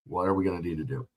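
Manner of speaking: ordinary talk, nothing marked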